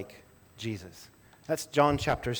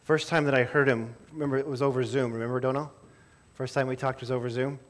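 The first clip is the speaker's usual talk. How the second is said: ordinary delivery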